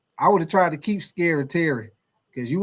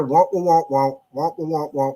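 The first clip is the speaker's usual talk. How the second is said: Charlie Brown womp; 2 semitones lower, slower than usual. Retold or imitated. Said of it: "the Charlie Brown voice".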